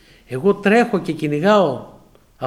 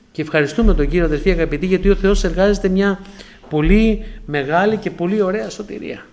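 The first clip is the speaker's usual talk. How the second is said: preaching; 2 semitones higher, 4 dB louder than usual. Acted. Preaching thanks.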